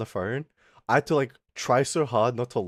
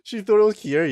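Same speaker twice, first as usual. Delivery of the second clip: higher, louder incredulous echo; surprised question; 9 semitones higher, 6 dB louder than usual. Real, not acted. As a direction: repeat it back in real surprise. Repeats a surprising question back.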